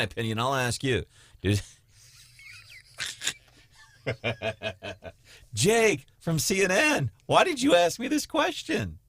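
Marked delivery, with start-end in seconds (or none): 5.5-9.0 high-pitched put-on protest; hammed-up pleading question, very high; mock exasperation. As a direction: play a cornered guest complaining, pitched way up. Acts out senator dodging interviewer's question.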